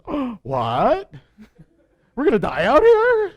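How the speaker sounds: high, loud mock shocked cry; preacher hams up the Israelites' alarm. Israelites hear they will die, mock shock.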